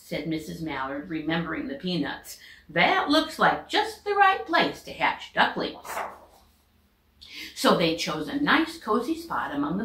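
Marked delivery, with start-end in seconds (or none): 2.7-5.9 higher, cheerful approval; bright higher voice of a happy mother duck; pleased duck mother. Acted. Missus Mallard approving the spot.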